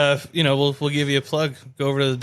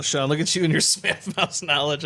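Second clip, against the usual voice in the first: ordinary talk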